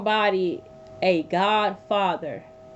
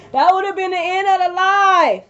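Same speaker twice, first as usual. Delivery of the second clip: higher and louder through laughter; laughing; 7 semitones higher, 6 dB louder than usual. Real, not acted. Streamer laughing about nearly falling off bed. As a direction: crack up at the thought.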